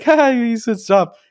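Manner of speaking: high, drawn-out teasing; mocking retort. Kids making fun of Ned's protest, mocking. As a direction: laugh it off in mockery.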